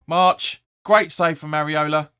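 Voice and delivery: sudden loud, high alarm at the chance; excited call. Real, not acted. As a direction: burst out as the shot comes in.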